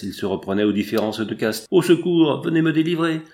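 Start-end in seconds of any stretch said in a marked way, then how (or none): 1.7-3.2 higher, louder cry for rescue; acted distress call, raised and urgent; anguished cry for help. A narrator's reading. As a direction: call out desperately to be saved. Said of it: "Un cri d'angoisse".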